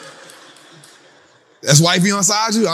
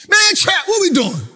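high, loud, whiny plea; mock panicked investor; 14 semitones higher, 4 dB louder than usual. Follower pleading with Trap, in a high mock panic. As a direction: squeal it like a worried follower.

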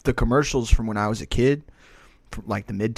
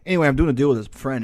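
ordinary talk

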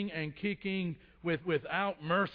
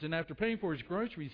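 usual speaking voice

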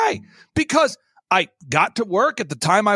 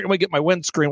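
ordinary delivery

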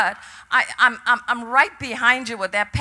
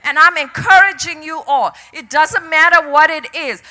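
loud, higher rallying exhortation; impassioned preaching; 4 semitones higher, 8 dB louder than usual. Real, not acted. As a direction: fire up the congregation against the enemy. Preaches at full force.

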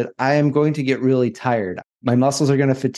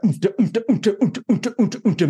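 imitated music beat; 9 semitones higher, faster than usual. Mimicking gym music beat.

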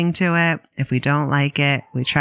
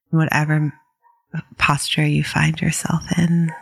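calm, unhurried meditation guidance with long pauses; guided meditation. Real, not acted. Meditation teacher guiding listeners to settle in, calm and unhurried. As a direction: ease listeners into settling, leaving space between phrases.

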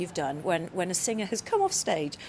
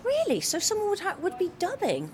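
amazed; 9 semitones higher than usual. Real, not acted. Astonished by dubbing singers.

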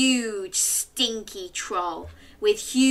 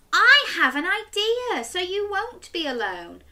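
put-on little girl voice; 10 semitones higher, 3 dB louder than usual. Chloe comforts witch.

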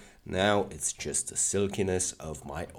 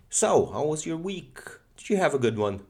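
high, eager check-in; bright upbeat; 10 semitones higher, 6 dB louder than usual. Real, not acted. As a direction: perk up and greet them. Bright greeting to listeners.

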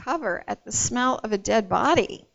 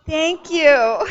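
laughing thanks; 5 semitones higher, 8 dB louder than usual. Real, not acted. Laughing thanks after congregation banter.

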